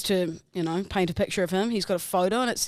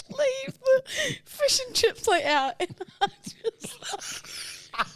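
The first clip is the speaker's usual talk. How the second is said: high, cracking laughter over the words; laughing through words; 14 semitones higher, slower than usual. Real, not acted. Telling friend the childhood story, laughing hard. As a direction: giggle helplessly as you tell it.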